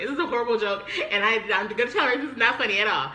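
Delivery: hammed-up tearful objection; retold crying complaint. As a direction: imitate an upset, indignant outburst. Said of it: "as I'm crying".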